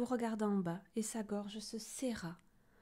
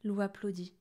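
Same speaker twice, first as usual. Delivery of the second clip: ordinary delivery, nothing marked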